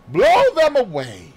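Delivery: high, loud comic puff. Acted. Poirot mimes blowing away trifles, comically loud. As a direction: blow the facts away with a flourish. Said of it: "puffed comically".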